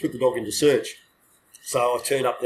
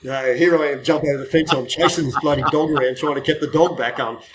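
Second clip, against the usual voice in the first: ordinary delivery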